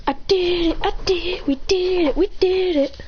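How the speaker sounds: high, chanting celebration; acted victory cheer. Boy celebrating the captured flag, high excited cheer.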